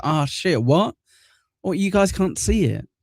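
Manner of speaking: high-pitched, puzzled disbelief; real surprise. Real, not acted. Streamer learning viewers can't see the green hair, surprised. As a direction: caught out, voice jumping up.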